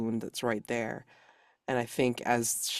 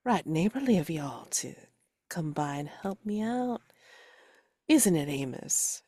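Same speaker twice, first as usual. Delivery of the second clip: folksy western woman; 5 semitones higher than usual. Acted. Character speaks in southern drawl.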